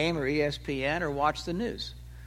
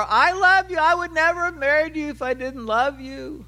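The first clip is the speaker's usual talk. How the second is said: much higher and louder, a hammed-up defensive husband; husband insisting he loves her, mocked; mock husband protest; 9 semitones higher, 6 dB louder than usual. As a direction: overplay the indignant husband's protest. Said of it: "He's not saying that".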